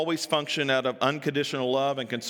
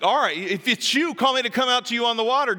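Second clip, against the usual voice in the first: higher and louder, rushed dare; bold Peter; 9 semitones higher, 4 dB louder, faster than usual. Acted. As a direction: toss the challenge out fast. Peter challenging Jesus again.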